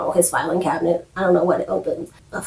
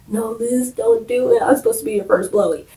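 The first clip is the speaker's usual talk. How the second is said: mock pleading; 3 semitones higher than usual. [name] pleading, acted out jokingly.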